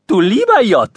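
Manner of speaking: high, loud squeaky Berlin exclamation; boy with breaking voice. A narrator's reading. Son exclaiming in Berlin dialect, high and squeaky. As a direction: blurt it out in a cracking adolescent voice. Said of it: "sagte Theochen".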